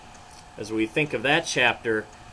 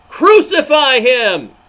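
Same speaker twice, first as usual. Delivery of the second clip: very loud, much higher, slow roar; thundering crowd; 11 semitones higher, 14 dB louder, slower than usual. Acted. Huge crowd demanding Jesus die, slow thundering roar. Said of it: "Louder than ever, the huge crowd thundered".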